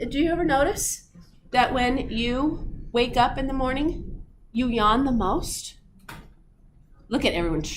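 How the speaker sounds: normal talking voice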